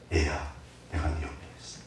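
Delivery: God whispering comfort to a lost one; tender divine voice. Acted. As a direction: comfort a crying child quietly.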